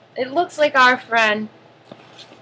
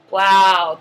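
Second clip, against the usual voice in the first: ordinary delivery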